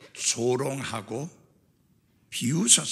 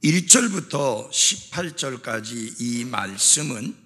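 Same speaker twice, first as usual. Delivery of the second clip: ordinary delivery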